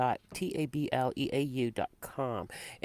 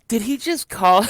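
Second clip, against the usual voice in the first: high, loud gasp of a question; shocked disbelief; 8 semitones higher, 9 dB louder than usual. Retold or imitated. Retelling to her cohost what she heard on TV. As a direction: ask it as if you can't believe your ears. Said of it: "I'm like".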